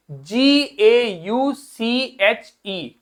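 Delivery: very slow letter by letter dictation; spelling out slowly. Shown as a demonstration. Teacher dictating the spelling for students to write. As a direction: spell it out so they can write it down. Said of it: "क्या लिखेंगे?".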